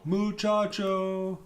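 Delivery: long drawn-out high call across the trail; yelling. Retold or imitated. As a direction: call out to the group, stretched slow. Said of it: "one of us would just yell".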